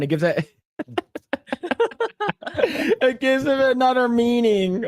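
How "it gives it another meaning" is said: high, drawn-out words through laughter; laughing. Real, not acted. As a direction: squeeze the line out while cracking up. Cracking up at his own joke.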